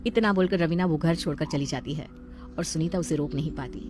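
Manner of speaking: usual speaking voice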